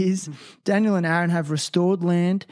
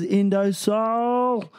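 drawn-out sponsor name; 5 semitones higher, much slower than usual. Acted. Host hyping his sponsor, drawn-out Indosol. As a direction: stretch Indosol out slow and high, like a sung call.

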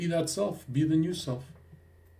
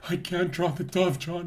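higher-pitched pleading; acted distressed patient; 5 semitones higher than usual. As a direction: plead helplessly with the therapist. Acted patient protesting to therapist.

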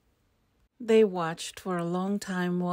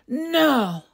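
loud, high, drawn-out no; storybook outburst of anger; angry refusal; 8 semitones higher, 7 dB louder than usual. A narrator's reading. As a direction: refuse outright, angrily. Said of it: "in an angry circles".

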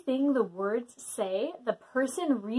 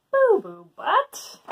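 slow, higher incredulous question; 7 semitones higher, 4 dB louder, slower than usual. Real, not acted. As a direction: repeat it in disbelief. Incredulous at name.

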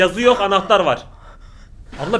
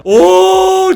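high startled yelp; 12 semitones higher, 12 dB louder than usual. Real, not acted. Scared by the film.

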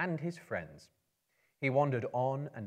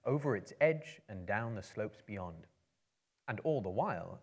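plain reading voice

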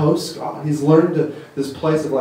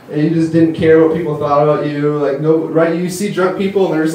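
usual speaking voice

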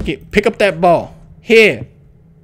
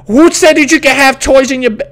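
loud, higher telling-off; playfully outraged parent voice; mock parental scolding; 5 semitones higher, 6 dB louder than usual. Scolding the game's child.

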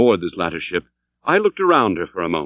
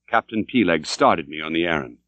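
ordinary delivery, nothing marked

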